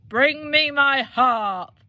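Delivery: slow, loud giant command; giant shouting. Acted. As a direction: bellow the order slowly. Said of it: "the giant shouted".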